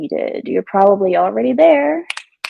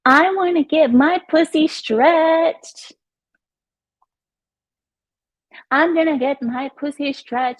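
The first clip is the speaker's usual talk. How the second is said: mock rapper quote; 7 semitones higher, slower than usual. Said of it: "She says".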